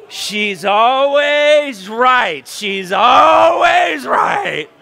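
high, loud, drawn-out wail; slow over-the-top cry of exasperation; exasperated proclamation. Acted. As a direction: stretch each word out loudly, hammed-up. Exasperated husband proclaiming about his wife.

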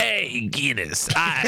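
slow, drawn-out mock Brooklyn call; attempted Brooklyn accent. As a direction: try a hammed-up Brooklyn accent. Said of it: "how would he sound in a Brooklyn accent".

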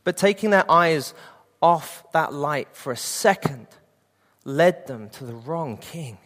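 usual speaking voice

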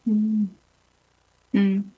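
usual speaking voice

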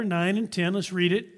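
ordinary talk, nothing marked

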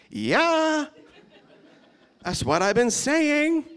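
high, slow, laughing told-you-so; wry Jesus. Retold or imitated. Jesus to the worshipping disciples, amused told-you-so. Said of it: "And Jesus is like".

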